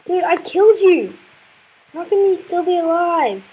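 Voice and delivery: high, loud, incredulous complaint; outraged gamer protest; exasperated disbelief. Real, not acted. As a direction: cry out in frustrated disbelief. Enemy survives after being killed.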